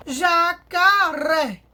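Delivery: jacaré very slow and loud, syllable by syllable; stress on RÉ. Shown as a demonstration. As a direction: drag out ja, ca, ré, landing on ré. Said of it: "Sílaba tônica é o ré".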